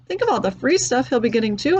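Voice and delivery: ordinary delivery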